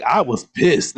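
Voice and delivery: usual speaking voice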